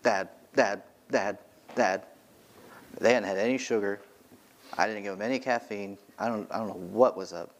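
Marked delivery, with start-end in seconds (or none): 0.0-2.0 put-on nagging child. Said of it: "they kept going".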